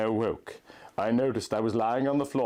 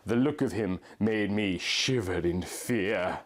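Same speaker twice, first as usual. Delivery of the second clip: ordinary delivery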